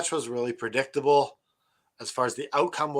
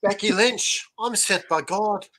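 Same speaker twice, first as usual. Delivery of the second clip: Irish angel voice; 6 semitones higher, slower than usual. Retold or imitated. Co-host mocks Becky Lynch in a slow put-on Irish accent. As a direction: play it like the Irish angel from Touched by an Angel. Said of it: "Touched by an Angel".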